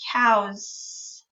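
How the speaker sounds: "Cows" with the final s held long. Shown as a demonstration. Teacher modelling "Cows" with a long final s. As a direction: stretch the s at the end of "Cows". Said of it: "make the S sound a little extra long".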